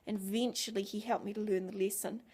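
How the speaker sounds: ordinary delivery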